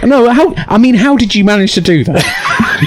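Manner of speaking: high-pitched incredulous demand; high mock outrage; mock angry wife. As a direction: imitate an exasperated partner demanding an explanation. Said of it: "angry, so angry as well".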